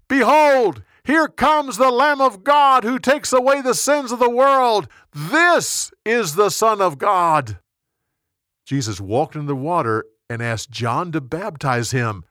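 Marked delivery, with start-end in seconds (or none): 0.1-7.6 high loud proclamation; shouting. A narrator's reading. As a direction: shout it to the whole crowd. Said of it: "John shouted to the crowd".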